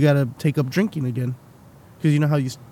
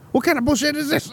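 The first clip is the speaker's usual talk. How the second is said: mock outrage; 10 semitones higher, faster than usual. Outraged at bar price.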